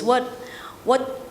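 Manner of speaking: normal talking voice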